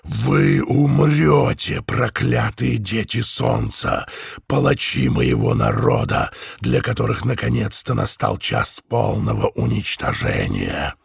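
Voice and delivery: slow, menacing, thunderous rasp; booming hoarse voice. Acted. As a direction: curse them like a vengeful spirit. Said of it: "хриплый и низкий голос".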